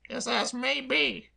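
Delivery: high, slow noncommittal drawl; noncommittal maid. Acted. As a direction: leave it hanging, evasive. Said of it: "declining to commit herself".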